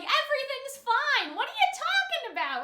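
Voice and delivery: mock-casual Marinette denial; breezy denial. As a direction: pretend nothing's wrong, airily. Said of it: "attitude that she's like".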